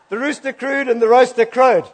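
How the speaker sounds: high, loud, laughing through "roaster"; laughing at the slip. Real, not acted. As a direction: giggle out the rooster and roaster mix-up. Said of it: "We got it".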